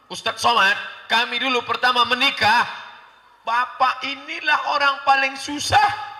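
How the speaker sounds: high, loud imitation of a woman; woman testifying. Voices a woman telling her story.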